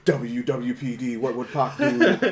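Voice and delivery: lightly put-on LA accent, amused; mock LA accent. Cohost imitating actor studying LA, light mock accent. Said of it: "that is an L A accent".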